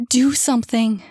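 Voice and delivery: loud begging; pleading shout. Acted. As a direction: beg the doctor to act. Mother begs the doctor.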